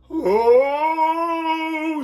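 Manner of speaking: very high, loud cry; pained shout. A narrator's reading. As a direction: cry out in pain. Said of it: "shouted Mary".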